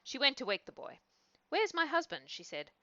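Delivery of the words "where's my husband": worried wife. A narrator's reading. Worried wife wakes the boy.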